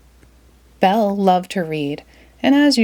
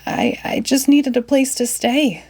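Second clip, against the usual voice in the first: a touch higher, stammering timidly; frightened old man pleading with the beast; 5 semitones higher than usual. A narrator's reading. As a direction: shrink back and stammer. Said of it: "said Maurice timidly".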